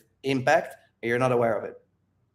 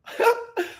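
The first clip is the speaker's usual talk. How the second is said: a high squeaky giggle at himself; laughing at his own joke. Real, not acted.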